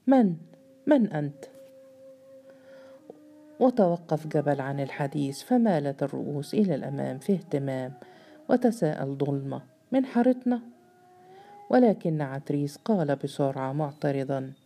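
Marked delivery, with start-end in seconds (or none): 0.1-1.4 mildly stammering, frightened question; a touch of fear, stumbling over the first word; fearful stammer. A narrator's reading. Jabal, frozen in the dark, asks the stranger in mild fear. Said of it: "وجلدي ينضح بالخوف". 9.9-10.6 who, from our alley?, said much higher in surprise; surprised question. Acted. Zalama cuts in on Jabal's tale, sharply surprised and much higher.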